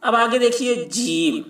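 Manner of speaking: ordinary talk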